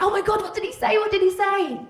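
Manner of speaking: very high, loud squealed questions; excited friend. Retold or imitated. Best mate asking about the lad's voicemail, excited squeal.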